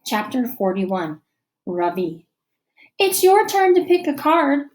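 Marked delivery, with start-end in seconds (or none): 3.0-4.7 much higher, louder teacher's voice; bright classroom prompt. A narrator's reading. Teacher tells a sulky boy it's his turn, much higher. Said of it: "says Missus Beam".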